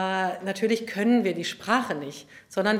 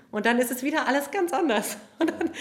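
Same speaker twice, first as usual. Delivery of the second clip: laughing through the words; amused, words breaking into laughter; 6 semitones higher than usual. Real, not acted. Laughs about singing differently.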